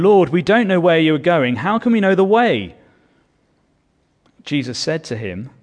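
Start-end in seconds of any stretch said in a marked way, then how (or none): 0.0-2.7 urgent pleading question. Acted. Voicing Thomas, loud and high.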